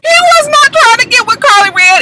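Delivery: high-pitched insistent denial; emphatic objection. Real, not acted. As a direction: jump in to contradict, pitch shooting up. Arguing back at the show.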